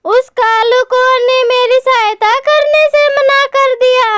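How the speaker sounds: high-pitched, troubled storybook mother; worried mother bird. Acted. (परेशान माँ चिड़िया की ऊँची आवाज़)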